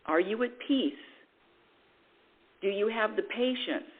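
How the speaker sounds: normal talking voice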